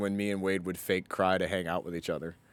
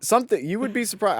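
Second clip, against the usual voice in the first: much higher, pushing back; emphatic protest; 12 semitones higher, 4 dB louder than usual. Real, not acted. Guest defending his sneaky childhood to cohost, excited high protest. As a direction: insist they'd be amazed, voice jumping high.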